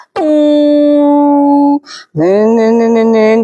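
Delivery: long held hum then nasal-syllable chimes; imitated gamelan chime. Preacher mocking gentle delivery with gamelan noises, sung chime. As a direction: ring it out like a struck gong.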